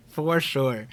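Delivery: normal talking voice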